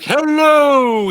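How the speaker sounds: a slow high sung hello; booming stomach voice. Retold or imitated. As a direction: stretch hello out like a cartoon stomach. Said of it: "that episode of Seinfeld where he's like".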